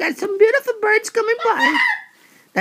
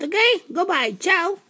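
ordinary delivery

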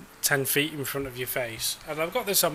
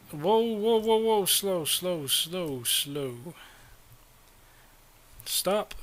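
urgent calling out; 4 semitones higher, much slower than usual. Real, not acted. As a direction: rein the bus in, calming as it slows. Bus runs away downhill.

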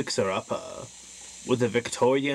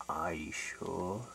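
ghostly voice; 7 semitones lower, 12 dB quieter than usual. Acted. Ominous spooky host question.

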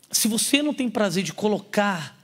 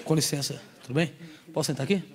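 suffering face; 5 semitones lower, 6 dB quieter than usual. Retold or imitated. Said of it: "com cara de sofrimento".

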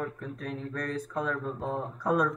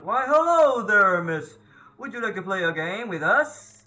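creepy host voice; 3 semitones higher, 5 dB louder than usual. Acted. Voicing creepy game host.

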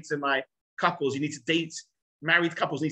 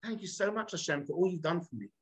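hushed prayer of gratitude; soft, humble acted prayer; quiet thanks; 9 dB quieter than usual. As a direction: drop right down and thank God softly. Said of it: "And yet at that moment, he says".

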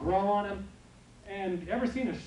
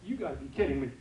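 dog voice; 7 semitones lower, much faster than usual. Retold or imitated. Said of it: "He said".

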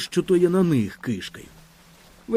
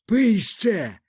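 put-on hoarse drunk grandfather; 7 semitones higher than usual. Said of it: "наврипилися дід".

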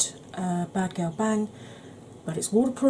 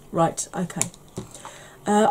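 ordinary delivery

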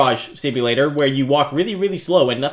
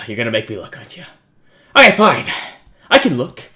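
ordinary delivery

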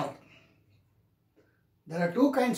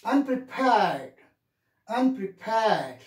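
"unprepared" drawn out slowly on "pared"; demonstrated word stress; slower than usual. Shown as a demonstration. Teacher drilling word stress, slow, stretched "unprepared". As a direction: stretch the last syllable of "unprepared". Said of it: "You have to get back".

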